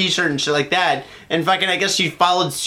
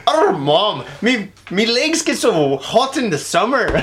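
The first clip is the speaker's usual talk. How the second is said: slow, higher mock Scottish boy; Scottish accent; 4 semitones higher, slower than usual. Kid complaining to his mom, shaky attempt at Scottish accent. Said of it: "a Scottish accent".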